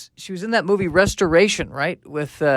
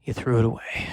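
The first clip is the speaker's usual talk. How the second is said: low bitter repeat; 5 semitones lower, 6 dB quieter than usual. Acted. Caveman repeats accusation.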